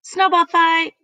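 high, loud battle cry; excited yell. A narrator's reading. Froggy starting a snowball fight, excited yell. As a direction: shout it out high like a rallying call. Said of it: "yelled Froggy".

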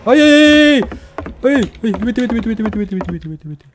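high squeal fading into rapid pleading; squeal, then chant it in panic; panicked squealing. Real, not acted. Player begs the chaser to leave, panicked squealing.

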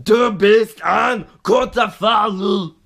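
loud, slow, high German shout; German accent. Pretend. Mock German proclamation by the vlogger.

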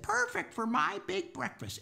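put-on high-pitched delighted boy; storybook child, voiced way up high; little boy character. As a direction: pipe up high like a pleased little kid. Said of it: "and he said".

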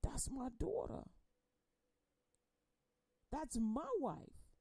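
hushed grief. Acted. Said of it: "God is like".

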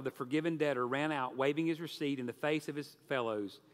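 ordinary talk

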